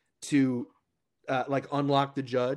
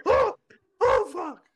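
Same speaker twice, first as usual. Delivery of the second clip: mock panicked judge; 7 dB louder, slower than usual. Judge's reaction retold.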